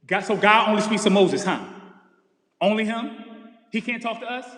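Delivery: loud, scoffing "only him?" complaint; mock indignant grumble. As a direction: sneer at the idea God only talks to Moses. People grumbling about Moses.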